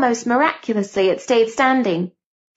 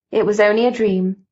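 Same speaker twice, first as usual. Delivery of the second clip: plain reading voice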